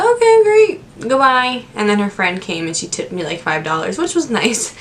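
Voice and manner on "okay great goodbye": high, bright fake-cheerful okay; sarcastic chirpy goodbye. To the angry customer, a mock-cheerful high goodbye. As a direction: send her off with a sing-song brush-off. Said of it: "I'm like".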